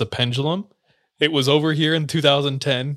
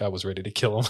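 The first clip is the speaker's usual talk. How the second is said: low, soft imitation of the kid; quiet retold confession; 4 semitones lower, 8 dB quieter, faster than usual. Kid eating chicken opens up about the fight, subdued. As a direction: understate the threat, voicing him quietly.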